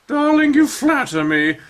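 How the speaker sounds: high, theatrical grande dame; high showy dance teacher; Madame Esperanza. Acted. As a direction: gush like a flamboyant instructor. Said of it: "Madame Esperanza voiced".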